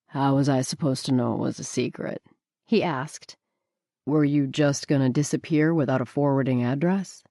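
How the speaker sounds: lightly voiced laid-back man; narrator's low Booker voice; Booker, teasing. A narrator's reading. Booker's lowered voice.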